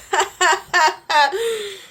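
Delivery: a bright high laugh that falls away. Real, not acted. Laughing at her own unfinished hair, high laugh. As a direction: laugh at yourself, high and loud.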